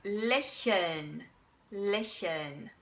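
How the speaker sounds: slow lächeln, hiss the ch; lächeln with ich sound; German lächeln. Shown as a demonstration.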